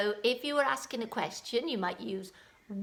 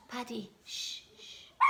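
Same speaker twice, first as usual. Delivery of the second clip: hushing the dog; 10 dB quieter than usual. Real, not acted.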